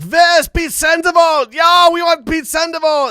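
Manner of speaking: high, loud, slow heckling shout; heckler shout. Retold or imitated. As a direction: yell like an obnoxious fan. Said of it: "some guy's like".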